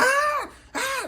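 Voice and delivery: very high-pitched mock scream; imitated scream. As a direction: scream in two high bursts, imitating a terrified girl. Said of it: "The girl screaming on the bed".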